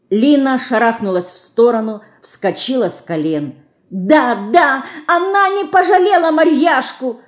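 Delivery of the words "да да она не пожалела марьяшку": put-on high, tearful child's voice in anger; furious little girl near tears; tearful angry cry. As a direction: cry out through tears of rage. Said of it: "со слезами и гневом кричала мышка".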